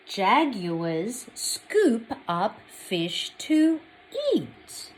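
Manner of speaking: slow, loud, clear picture-book reading; story reading. Acted. Teacher reading a picture book to children, slow and clear. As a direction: read each page slowly to little ones.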